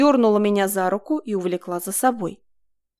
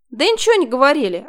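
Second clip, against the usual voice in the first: higher, louder snap; snapping; 5 semitones higher, 6 dB louder than usual. A narrator's reading. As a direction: snap back irritably. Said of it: "огрызнулась Марина".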